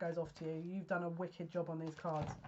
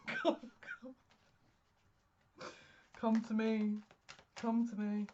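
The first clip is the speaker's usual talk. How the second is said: a lightly mystic beckoning voice; beckoning call; 3 semitones higher than usual. Pretend. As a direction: call the cards to you, half playful. Calling cards from spirit.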